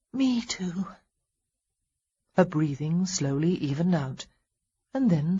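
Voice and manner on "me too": drowsy whisper; half-asleep murmur; sleepy. Acted. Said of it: "she said sleepily".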